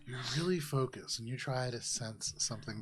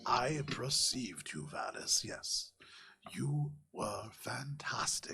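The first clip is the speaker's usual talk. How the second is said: hushed, whispery praise, drawn out; slow-talking mystic Javaris; slower than usual. Acted. Javaris praises Valis after the bards' battle, slow hushed mystic. Game master voices Javaris.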